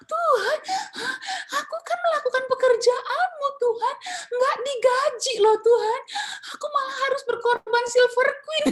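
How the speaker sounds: high, loud crying lament; sobbing complaint. Retold or imitated. Humiliated choir teacher at home complaining to God: loud sobbing lament. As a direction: wail your grievance to God through sobs. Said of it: "saya menangis dengan begitu keras".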